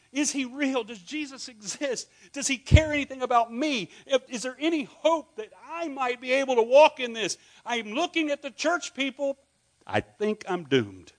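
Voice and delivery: a hint of a lost soul pleading; desperate seeker.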